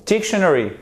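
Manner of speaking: dictionary punched on DIC, then falling; first-syllable stress. Shown as a demonstration. Teacher giving learners the right accent, stress on first syllable. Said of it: "c'est sur la première syllabe".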